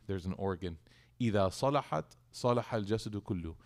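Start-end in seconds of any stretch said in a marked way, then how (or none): 1.2-3.6 recitation. Acted. Reciting hadith in Arabic.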